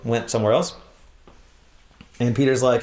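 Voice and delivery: normal talking voice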